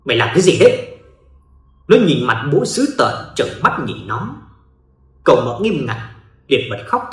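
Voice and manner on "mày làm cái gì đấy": louder, forceful angry demand; harsh growl. Acted. Father catching his son in the hallway, angry and loud. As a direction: bite down hard on every word. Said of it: "gằn giọng".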